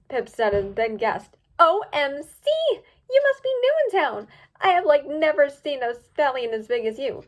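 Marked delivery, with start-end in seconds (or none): 1.6-7.2 high, loud gushing squeal; excited. A narrator's reading. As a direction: gush over the giant stranger. Pip excited.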